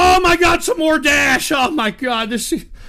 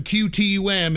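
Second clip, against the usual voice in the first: ordinary delivery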